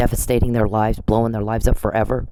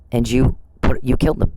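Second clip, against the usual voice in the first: ordinary delivery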